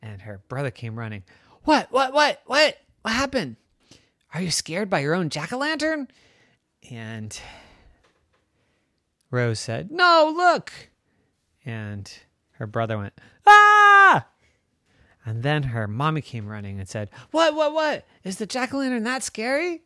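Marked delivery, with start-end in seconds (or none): 1.7-6.1 high-pitched, urgent questioning; alarmed brother. Acted. Brother running to his screaming sister, alarmed. 9.9-10.7 alarmed insistence. Acted. Rose insists brother look at it. 13.4-14.2 loud high-pitched scream; brother's scream. Acted. Brother sees the jack-o'-lantern, screaming. 17.3-19.8 high-pitched, urgent questioning; alarmed mommy. Acted. Mommy running to her screaming children, alarmed.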